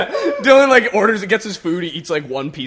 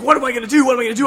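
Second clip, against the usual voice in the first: high, raised voice in imitated panic; mock panic; 7 semitones higher, 5 dB louder, much faster than usual. Driver to friends at blocked road, mock panicked. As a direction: play a driver starting to panic.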